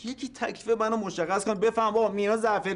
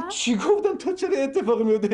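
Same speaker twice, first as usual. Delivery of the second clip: indignant; 3 semitones higher, 5 dB louder than usual. Acted. Husband protesting to mother-in-law, loud and indignant. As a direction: push back hard. Husband defends himself loudly.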